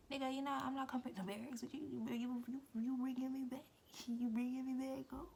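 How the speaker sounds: high, rapid indignant outburst; indignant protest. Retold or imitated. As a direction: protest in high-pitched, incredulous disbelief. Retold argument in car.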